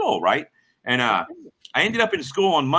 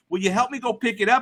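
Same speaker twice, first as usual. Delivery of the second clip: high-pitched imitation of a stranger's plea; bum asking favor; 9 semitones higher than usual. Bum wants help fetching a generator, high-voiced request. Bum asks favour, retold.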